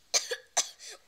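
loud, hammy fake cough fit. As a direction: cough it up theatrically. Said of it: "Let's pretend coughs".

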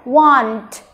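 "want" with a clearly released T; final T released. Shown as a demonstration. Teacher reminding learners of the ending, WANT with popped final T. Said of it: "Bật âm cuối này".

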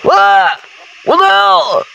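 high-pitched yelp of alarm; startled yell. Real, not acted. Player startled by Sonic in horror game, startled yell. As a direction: cry out in startled disbelief.

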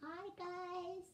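small, high, soft character voice; Boo. Pretend. Greeting viewers as a pet, small high voice. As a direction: squeak it out softly and high. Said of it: "I'm going to do Boo's voice".

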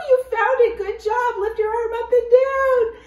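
high, loud cheering; excited praise. Real, not acted. Teacher to children, high excited praise. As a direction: cheer them on.

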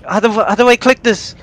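high, loud panicked plea; frantic, the spider is on you. Real, not acted. Spider attacking in game.

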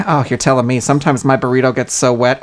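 seduce them with burrito talk; mock sultry. Comic speaker praising soggy burritos, low and seductive.

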